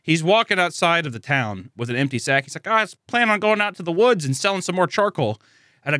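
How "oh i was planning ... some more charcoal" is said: high-pitched, breezy character imitation; naive hero. Hero telling villager his plan, in mock innocence. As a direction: play the oblivious boy, pitched up.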